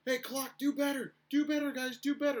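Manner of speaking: high, loud taunting sing-song; mock a heckler jeering the scorekeeper; mocking bench chirp. Guy on opposing bench heckling the clock crew, high mocking jeer. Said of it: "He was like".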